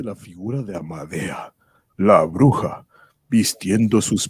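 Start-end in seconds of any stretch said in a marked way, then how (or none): none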